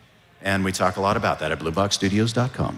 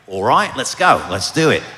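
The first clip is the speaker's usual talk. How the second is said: put-on bold, louder Australian accent thrown together on the spot; improvised Australian accent, louder and committed; 3 semitones higher, 8 dB louder than usual. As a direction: jump straight into an Australian accent, loud and game. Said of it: "we decided to go Australian, so go".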